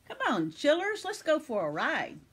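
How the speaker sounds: put-on rally the kids for an adventure; rallying call. A narrator's reading. Grandude urges the children onto flying fish, rallying.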